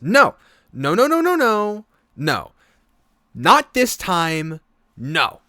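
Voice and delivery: put-on high, loud stubborn child refusing; defiant little girl. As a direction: stamp your foot and refuse. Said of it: "And she goes".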